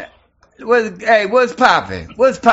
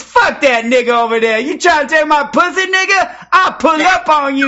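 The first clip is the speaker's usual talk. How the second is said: ordinary talk, nothing marked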